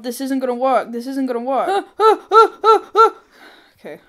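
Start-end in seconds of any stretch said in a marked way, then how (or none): 1.7-3.1 string of short, high, loud screams; panicked screaming. Real, not acted. Gamer running out of air underwater, screaming. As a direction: yelp repeatedly in alarm. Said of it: "Sorry for screaming".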